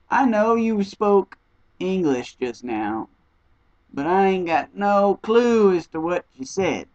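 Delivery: slow, drawn-out mock bafflement; slowly voiced, hammed-up incomprehension; puzzled reply. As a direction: stretch it out, exaggeratedly clueless. Acts out reply to garbled English.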